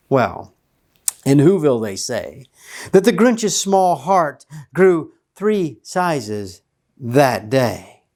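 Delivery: usual speaking voice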